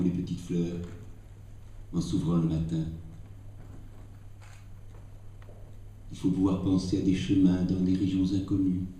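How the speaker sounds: measured recital of a Rilke prose passage; poem recitation. Acted. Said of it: "Je vais tenter de vous dire un court passage". (lecture récitée d'un passage de Rilke)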